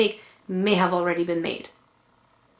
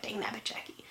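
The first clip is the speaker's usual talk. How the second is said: whispered self-scolding; frustrated whisper; 18 dB quieter than usual. Retold or imitated. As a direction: hiss it under your breath at yourself. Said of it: "when I mess up, I'm like".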